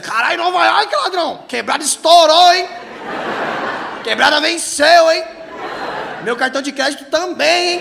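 high, loud street exclamation; hammed-up impressed buddy; mock amazed hype. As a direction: stretch the key words, loud and high. Imagined audience reacting to his brag.

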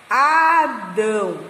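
"Adão" very slow, split as A - dão; syllable-by-syllable. Shown as a demonstration. Teacher joining syllables for the class, slow and split. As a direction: stretch out both syllables of "Adão". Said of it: "a junção dessas sílabas".

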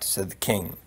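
usual speaking voice

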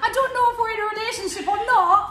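high, loud Geordie send-up; mock Geordie impression. Friend mimicking pal's relationship drama, mock Geordie. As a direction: wail it in exaggerated Geordie. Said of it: "it was just you going".

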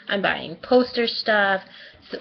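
ordinary talk

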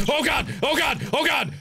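high panicked yelling; terrified gibbering. Real, not acted. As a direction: yell in panic as you flee. Fleeing, leg breaks.

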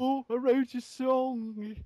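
high, slow Scouse Beatle impression; mock Liverpudlian accent. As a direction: drag out a silly Liverpudlian voice. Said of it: "brummy Liverpudlian accent".

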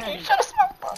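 very high, frantic yelling; panicked high yell. Real, not acted. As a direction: voice cracking up in panic. Panicked yelling during fight.

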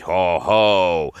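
loud mocking goblin chorus; great ho-ho. A narrator's reading. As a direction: boom it out as a jeering crowd. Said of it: "all the things joined in with a great".

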